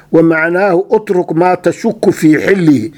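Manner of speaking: normal talking voice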